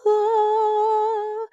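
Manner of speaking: high, drawn-out imitated gasp of delight; mock the boss's glee; gleeful gasp. Imitating the boss delighted at her mistake, a high gasp. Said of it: "she was like".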